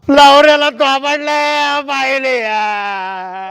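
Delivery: loud start, long drawn-out falling finish; drunken wailing. Acted. Drunk husband laments to unwilling wife, slow drawn-out wail. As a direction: stretch every word into a wail.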